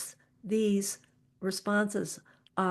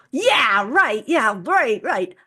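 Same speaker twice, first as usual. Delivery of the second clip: loud, emphatic yeah right; match their charged energy, much louder; energetic agreement; 2 semitones higher, 10 dB louder, slower than usual. Shown as a demonstration. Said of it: "you go".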